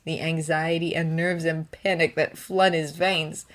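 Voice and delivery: ordinary delivery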